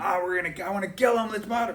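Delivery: high, hammed-up angry ranting; mock rage. Partner threatening the other side, mock furious. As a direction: play the partner who wants to kill him. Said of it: "using language like that".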